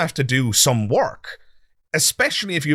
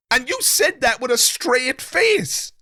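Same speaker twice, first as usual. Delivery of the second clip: amazed disbelief; 13 semitones higher than usual. Real, not acted. Host marvels, repeating the line.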